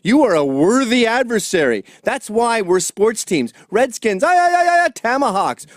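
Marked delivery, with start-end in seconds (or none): 3.7-5.7 high, loud stadium whoop; mock fan cheer.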